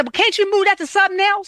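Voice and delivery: high, coaxing voice of a friend who won't take no; pushy friend. Retold or imitated. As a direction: mimic someone asking you to cancel plans. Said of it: "they try to say".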